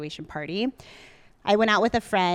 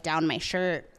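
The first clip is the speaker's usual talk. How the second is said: normal talking voice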